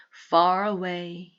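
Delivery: ordinary delivery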